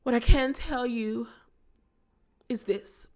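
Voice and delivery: mock a grand, theatrical confession with a put-on accent; overly profound Downton Abbey accent, imitating Meredith; Meredith's posh accent. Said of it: "this accent and the dramatics and these profound dialogues".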